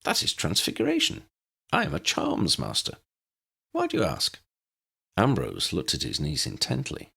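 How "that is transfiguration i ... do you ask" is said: put-on high, bright little old wizard, kindly reassuring; cheerful Professor Flitwick. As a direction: pitch it up as the tiny professor soothing Lydia. Narrator voices Flitwick.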